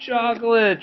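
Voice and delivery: high dismayed cry; a child wailing at the shock. A narrator's reading. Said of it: "she cried".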